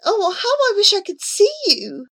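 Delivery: high, plaintive wish; weeping with longing. A narrator's reading. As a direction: say it tearfully, aching with longing. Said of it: "he wept, so full of longing".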